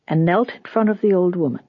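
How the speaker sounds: plain reading voice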